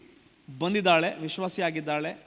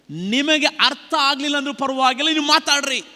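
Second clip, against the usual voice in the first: forceful preaching; 6 semitones higher, 7 dB louder than usual. Real, not acted.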